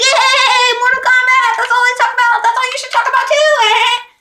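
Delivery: high, loud, hammed-up kid voice; mocking the annoying kid. Classmate pushing his favourite game on her, high hammed-up squeal. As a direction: overdo the hyper gamer kid. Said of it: "He's like".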